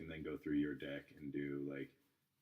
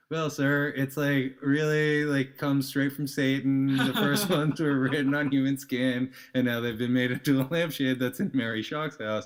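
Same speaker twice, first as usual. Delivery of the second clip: mock sinister pitch; 8 semitones higher, 11 dB louder than usual. Teasing a British tourist with a loud mock-ominous tarot pitch. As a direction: spin a tall tale with a straight face, loudly.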